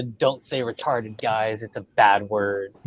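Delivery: a soft, slow, preachy hint of a scold; mock preachy scolding. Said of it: "trying to drive that nail into".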